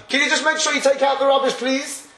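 mock wife calling from upstairs to take out the rubbish. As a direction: call out a request like the wife. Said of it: "you hear a voice coming from upstairs".

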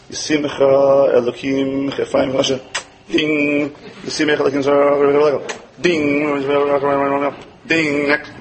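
rote, slow, loveless recital like a typewriter; mock assembly-line blessing; mechanical blessing. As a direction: rattle it off with no feeling. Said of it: "like those old-fashioned typewriters".

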